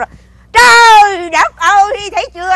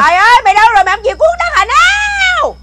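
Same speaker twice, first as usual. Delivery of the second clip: shouting for him; 7 semitones higher than usual. Acted. Wife shouting for the boy.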